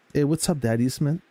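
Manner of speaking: ordinary delivery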